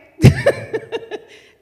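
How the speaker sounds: high, loud burst of laughter; real laughter. Real, not acted. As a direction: break into a big delighted laugh. Burst of laughter after reveal.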